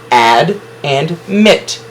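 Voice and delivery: "ad" and "mit" said slowly, each one apart; slow syllable split. Shown as a demonstration. Teacher splitting admit slowly into syllables for students. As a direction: space out "ad and mit" deliberately. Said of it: "two closed syllables".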